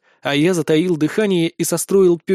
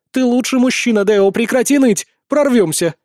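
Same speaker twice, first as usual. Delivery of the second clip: higher-pitched rallying cheer; upbeat; 6 semitones higher, 4 dB louder than usual. A narrator's reading. Mercenary tells her man to stop whining, upbeat. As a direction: buck him up with spirited confidence. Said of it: "ответила наёмница приподнято".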